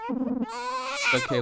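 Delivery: very high, slow cooing; falsetto soothing. Acted. Player soothing the crying game baby. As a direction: coo gently like to a baby.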